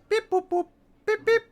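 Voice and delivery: high robotic beep-boop noises. Acted. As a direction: beep like a little droid. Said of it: "and says".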